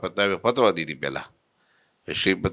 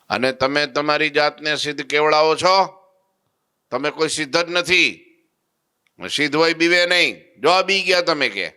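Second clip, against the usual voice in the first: higher and louder, stern rebuke; scolding; 8 semitones higher, 4 dB louder than usual. Retold or imitated. Holy man scolds the siddhas, loud stern rebuke. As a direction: tell them off with authority.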